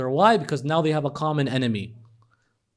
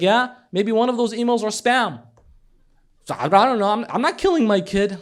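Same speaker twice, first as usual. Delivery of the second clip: mocking professor; 8 semitones higher, 8 dB louder than usual. Professor retorts, voiced loud.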